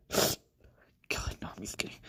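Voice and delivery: breathy aside under his breath; whisper. Real, not acted. Streamer mutters to himself after the viewer leaves, whispering. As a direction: whisper it to yourself.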